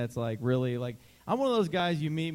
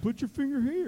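higher coaxing guide voice; high instructing voice; 10 semitones higher than usual. Retold or imitated. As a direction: instruct a child gently. Imitating the guide.

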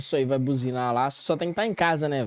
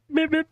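motorbike horn imitation; 11 semitones higher than usual. Shown as a demonstration. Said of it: "faz o barulhinho da moto, buzina".

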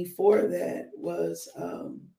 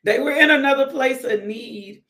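louder and higher, preaching; emphatic; 5 semitones higher, 5 dB louder than usual. Shown as a demonstration. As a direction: repeat it with preacher's fire. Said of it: "Come on, it's a word".

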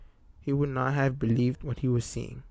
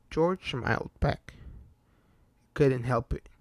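usual speaking voice

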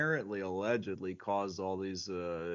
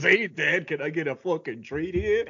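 put-on loud, higher whiny kid begging his dad; bratty kid voice; 5 semitones higher, 9 dB louder than usual. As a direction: beg Dad for a treat like a spoiled sitcom kid. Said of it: "is the one who goes".

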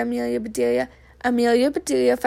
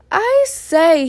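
raised, higher-pitched call; 5 semitones higher, 7 dB louder than usual. A narrator's reading. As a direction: call out to someone in another room. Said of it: "he called".

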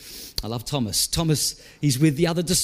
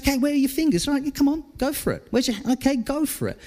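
higher, easy, encouraging voice; inviting Jesus; 7 semitones higher, much faster than usual. Acted. Jesus inviting Thomas to touch his wounds, higher and encouraging. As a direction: coax him on kindly, pitched up.

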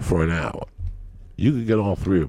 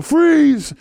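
loud high FREEZE bellowed; shouted robbery command; retold robber yell; 7 dB louder than usual. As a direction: storm in and yell it. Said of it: "he'd kick down the door".

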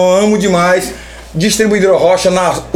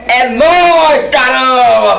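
higher, louder cheering; excited shout; 4 semitones higher, 3 dB louder, slower than usual. Real, not acted. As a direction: whoop it out in triumph. Cheering after the message. (comemoração gritada)

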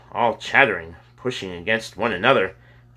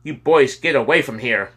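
higher-pitched, mildly snarling warning; snarled order; 5 semitones higher, 4 dB louder than usual. A narrator's reading. Important man snarling at boys to leave. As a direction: shoo them off with some bite. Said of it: "looked their way and snarled".

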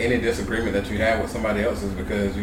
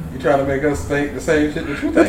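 laughing; 6 semitones higher, 4 dB louder than usual. Real, not acted. Laughing while teasing back.

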